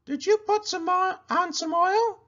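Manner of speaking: a llama giving friendly advice; Cherry Sue voice. A narrator's reading. Llama advising her friend the pig, high and sweet. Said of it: "asked Cherry Sue".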